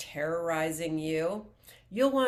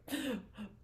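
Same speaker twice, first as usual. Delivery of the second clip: short laugh; 5 semitones higher, 9 dB quieter than usual. Real, not acted. Light aside about kicking the dog.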